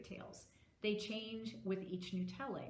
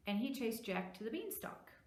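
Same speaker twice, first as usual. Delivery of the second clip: plain reading voice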